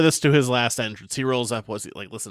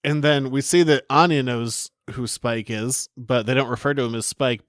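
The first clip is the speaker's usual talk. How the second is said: ordinary delivery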